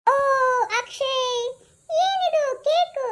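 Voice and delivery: choked-up, teary voice, slow and high; tearful. Acted. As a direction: near tears, overwhelmed by the surprise. Aunt moved by surprise cake.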